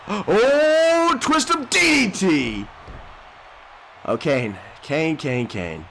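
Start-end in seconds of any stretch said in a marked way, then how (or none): 0.1-2.7 excited shout. Real, not acted. Gamer calling a twist of DDT, excited shout. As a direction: call the move like a fan.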